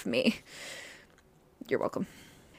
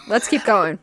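normal talking voice

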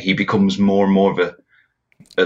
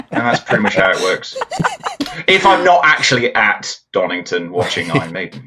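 ordinary talk

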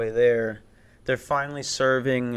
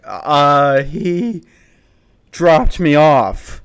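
halting, drawn-out lame excuse; nervous; 2 semitones higher, 7 dB louder, much slower than usual. Retold or imitated. Nervous passenger answering the guard about his cousin, halting and drawn out. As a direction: imitate your own flustered answer, loud and stretched. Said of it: "That's how I said it".

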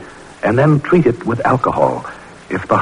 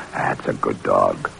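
much lower, softer and slow; soft praise; 8 dB quieter, slower than usual. Acted. Researcher softly praising the dog that survived. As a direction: murmur it gently to the dog.

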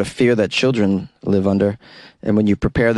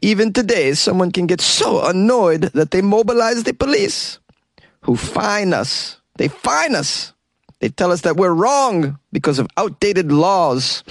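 high, hammed-up Italian accent with a whisper; 7 semitones higher than usual. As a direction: overdo a wounded Italian owner. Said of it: "that's him in a video".

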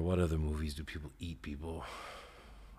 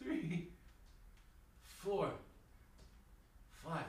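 straining through reps; 9 semitones higher, 15 dB quieter, slower than usual. Real, not acted. Counting reps mid push-ups.

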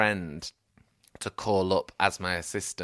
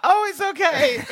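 high mock-polite voice; 7 dB louder than usual. Mimicking himself placating builder.

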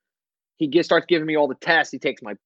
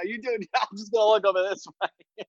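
higher, laughing line to the doctor; laughing aside; 5 semitones higher than usual. Retold or imitated. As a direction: laugh through it, looking away. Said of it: "I go".

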